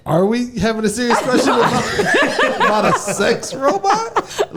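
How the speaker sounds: high incredulous laugh-talk; laughing disbelief. Real, not acted.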